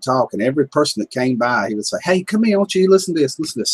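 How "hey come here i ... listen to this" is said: calling people over. Retold or imitated. Guy calling passersby over.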